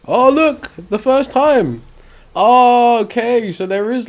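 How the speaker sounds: loud, high cry of discovery; real high-pitched excited outburst, easing as it ends; excited surprise. Real, not acted. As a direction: call out high and loud, amazed at the game. Game moment surprises the player.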